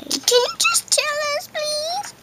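high, pleading put-on voice; squeaky pleading. Pretend customer begs the waiter for a table, high and pleading.

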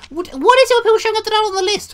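high, loud, fast disbelieving yell; exasperated doorman yelling at an unlisted visitor; outraged shout. Real, not acted. Outraged at visitor not listed.

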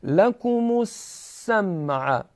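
السمع with a sharp doubled س, slowly; doubled clear seen. Shown as a demonstration. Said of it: "سین کاملاً شفاف و با تشدیدی". (سین مشدد و شفاف در السمع)

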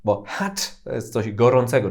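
ordinary talk, nothing marked

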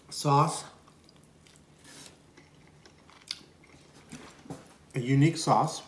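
chewing between words; talking while eating; mouth full of pizza. Real, not acted. Seen 1: Holds pizza, bit at -3s; mouth moving, seems partly full.

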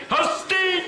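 very high scolding cry; shrill mother's yell. Retold or imitated. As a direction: shriek it like an Odessa mother. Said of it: "Им кричали".

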